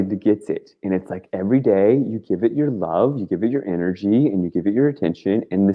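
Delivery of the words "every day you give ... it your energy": usual speaking voice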